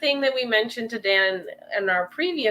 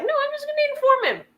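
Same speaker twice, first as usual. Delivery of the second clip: much higher, quick, matter-of-fact; breezy resolve; faster than usual. Retold or imitated. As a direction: chirp it out as a cheerful decision. Voicing her own new resolve.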